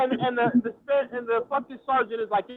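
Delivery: normal talking voice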